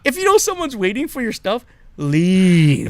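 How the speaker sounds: high mock plea into a loud, drawn-out last word; exasperated plea. Telling dawdlers to free the spot, exasperated. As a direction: build up pitched high, then belt the ending. Repeats the plea with punched ending.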